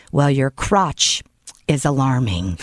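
very low, slow monotone; imitating a grave officer; deadpan agent. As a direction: break it up with a solemn pause, deep voice. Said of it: "Just like that".

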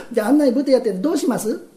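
ordinary talk, nothing marked

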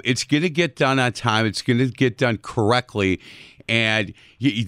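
normal talking voice

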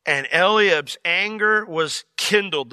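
ordinary talk, nothing marked

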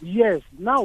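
ordinary talk

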